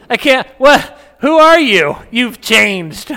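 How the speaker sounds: high loud incredulous wife voice, hammed up; mock bewildered wife. Wife pretending not to know him, high loud disbelief. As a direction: gasp it out, high and shocked. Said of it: "Lydia's like".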